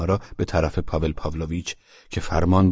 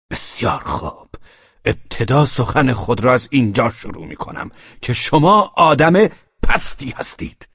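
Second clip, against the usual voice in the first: halting shout; 6 semitones higher, 6 dB louder than usual. A narrator's reading. Velchaninov shouts at Pavel that he is vile. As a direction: spit out the insult, rising to a yell. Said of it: "بریده بریده فریاد کشید".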